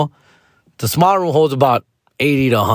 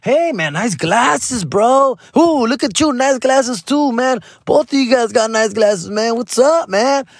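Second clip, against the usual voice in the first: Joy Coy voice; 11 semitones higher, slower than usual. Retold or imitated. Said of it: "He's like (Joy Coy greeting)".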